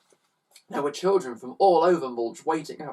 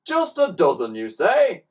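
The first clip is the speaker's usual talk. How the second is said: teasing, slightly higher and louder; a sly offer; mischievous; 5 semitones higher, 6 dB louder than usual. A narrator's reading. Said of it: "asked Slime mischievously".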